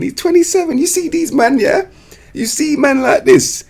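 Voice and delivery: very high incredulous squeak; squeaky disbelief. Real, not acted. YouTuber ranting to viewers about lying players, very high and squeaky. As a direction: go way up into a squeaky rant.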